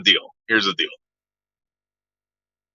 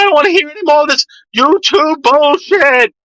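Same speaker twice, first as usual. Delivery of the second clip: much higher, mocking impersonation of an angry guy; [name]'s voice; 12 semitones higher than usual. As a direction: squeak out his rant like you're mocking a friend. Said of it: "That's how this guy talks".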